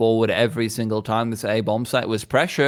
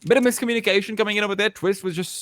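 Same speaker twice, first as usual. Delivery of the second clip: fast excited; 8 semitones higher, faster than usual. Real, not acted. Rapid caster call.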